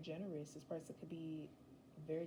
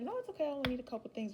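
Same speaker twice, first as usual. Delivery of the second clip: quick brush-off; 7 semitones higher, 5 dB louder, much faster than usual. Acted. Acted quote, you to partner.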